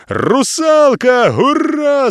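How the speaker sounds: high, loud, drawn-out cheer; excited shout. A narrator's reading. Captain whooping at the mermaid catch, high shout. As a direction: whoop it out at the top. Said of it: "закричав капітан".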